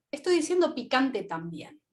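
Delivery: ordinary delivery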